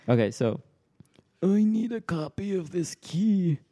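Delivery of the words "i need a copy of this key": put-on high, creaky old man. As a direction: play a frail old man asking. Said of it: "So this is the old man voice".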